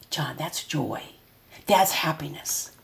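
name the feeling kindly for him; simple gentle naming. Shown as a demonstration. Parent to son, the right way: soft gentle naming. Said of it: "But I'll say".

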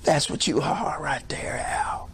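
imitating a low, hushed word from God; God speaking to Al. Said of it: "he's weeping and he said".

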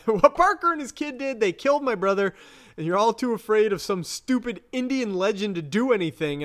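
high, indignant retelling; angry voice. Retold or imitated. Retelling the woman whose brother was killed, acted anger. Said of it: "my default angry voice".

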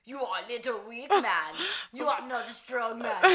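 loud, slow muscleman voice; strongman. Acted. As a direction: boom it like a circus strongman. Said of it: "strongman voice".

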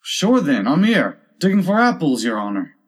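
high, loud answer falling away at the end; lilting servant, dropping on the closing address; servant's voice. A narrator's reading. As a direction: call back to the master as his servant. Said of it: "a voice she had never heard before".

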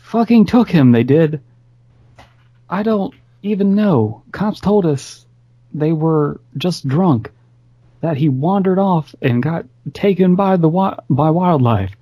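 put-on high, strained drunken sobbing; laughing into tears. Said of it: "his laugh turned, uh, suddenly to tears".

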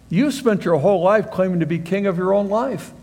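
usual speaking voice

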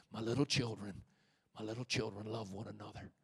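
softly voiced aged apostle, fading down; a hint of the elderly John, tender and faint; old apostle's voice. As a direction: murmur it like an old man at the end of his life. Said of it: "gravelly voice".

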